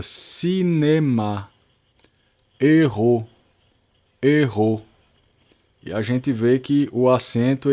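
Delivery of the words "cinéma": cinéma drawn out slowly, every syllable the same force; very slow cinéma with flat, even weight; even syllable stress. Shown as a demonstration. Teacher stretching out the word for students, even stress. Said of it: "a mesma força".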